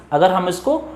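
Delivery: ordinary delivery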